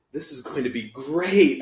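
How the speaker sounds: yelling. Acted. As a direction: shout it across the classroom. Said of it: "Oliver yells".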